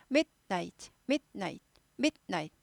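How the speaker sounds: each midnight cut into mit, night; hard stop in the middle of every midnight; staccato midnight. Shown as a demonstration. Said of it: "끊는 데가 많아요".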